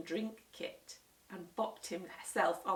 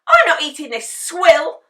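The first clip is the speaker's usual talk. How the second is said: high, loud furious shriek; 9 semitones higher, 22 dB louder than usual. A narrator's reading. Henry refusing his supper, shrieking loud. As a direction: scream it at the sandwich. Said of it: "shrieked Henry".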